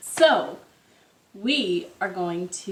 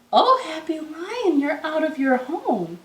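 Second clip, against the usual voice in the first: higher, lightly voiced boy; a kind child finding the lost lion; gentle boy's surprise; 7 semitones higher than usual. Francois finding the lion out of the zoo, higher and surprised.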